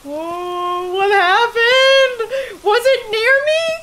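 high, slow, scared voice; frightened. Real, not acted. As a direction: ask in real alarm. Ghost hunt, sudden fright.

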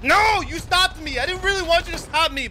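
high-pitched, exasperated complaint. Real, not acted. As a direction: cry out in dismay at being stopped. Protesting after being killed in game.

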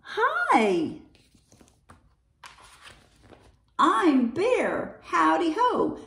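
high, friendly sing-out; high-pitched storybook hello; bear's greeting. A narrator's reading. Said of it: "And the bear says".

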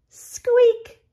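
very high, loud squeak; rubber duck squeak. A narrator's reading. Little rubber duck squeaking goodnight very high. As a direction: squeeze out a toy-duck squeak. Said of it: "says the little rubber duck".

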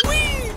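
high, loud squeal; short squealed whee of play; gleeful whee. Pretend. Gamer grabbing people, squealing with glee.

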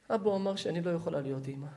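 low, soft, quick admission; hushed, acted heartbreak; sad confession. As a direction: let the bad news out quietly. Daughter reveals devastating diagnosis.

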